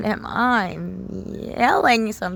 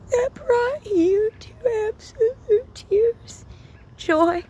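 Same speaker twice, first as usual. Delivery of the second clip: higher, slow, voice breaking into tears; real crying; 5 dB louder, slower than usual. Real, not acted. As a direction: let the tears break through, slowly. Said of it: "It's gonna make me cry".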